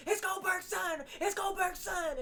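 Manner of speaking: shocked commentary shout; announcer shout. Retold or imitated. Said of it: "They're like".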